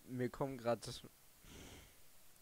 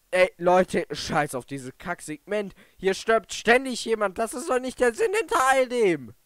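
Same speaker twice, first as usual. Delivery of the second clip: angry rant; 6 semitones higher, 10 dB louder than usual. Real, not acted. Raging at the game.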